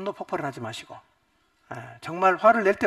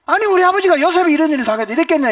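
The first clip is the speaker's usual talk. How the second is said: high, loud indignant protest; bitter sons accusing their father; resentful sons; 10 semitones higher, 11 dB louder than usual. Retold or imitated. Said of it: "아들들의 마음에 어떤 생각이 들까요".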